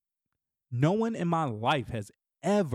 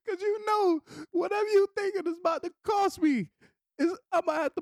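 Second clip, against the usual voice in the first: laughing; 12 semitones higher than usual. Real, not acted. Laughing while complaining about daughter.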